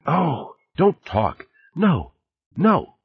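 high, little gasped exclamations; acted short gasps, pitched well up; gasping. A narrator's reading. Woman on the tape mid-embrace, gasping high. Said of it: "He heard her gasping in little breaths".

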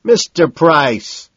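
hearty holler across the club; shouted greeting. A narrator's reading. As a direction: draw the name out as you shout it. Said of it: "shouts Madison".

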